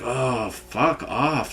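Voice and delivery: soft, slow, fed-up mutter; quiet disgusted brush-off; muttered curse. Retold or imitated. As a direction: drag it out under your breath. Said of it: "I was like".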